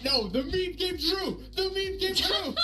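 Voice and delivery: laughing disbelief. Real, not acted. Disbelief at the meme.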